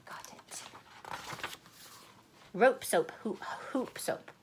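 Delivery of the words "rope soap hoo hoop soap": usual speaking voice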